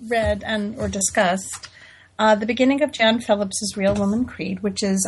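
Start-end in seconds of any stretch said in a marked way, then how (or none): none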